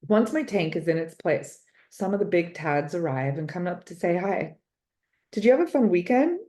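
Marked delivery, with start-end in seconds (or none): 5.3-6.4 put-on a boy's loud, quick, eager question; bright and fast, a kid calling out to his frog. A boy greets the class frog in his loud voice. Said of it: "in that loud voice of his".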